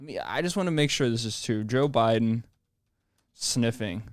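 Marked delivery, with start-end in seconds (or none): none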